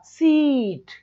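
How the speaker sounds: सीट with a long held "seeeet"; stretched vowel. Shown as a demonstration. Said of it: "हमें लंबा खींचना पड़ेगा".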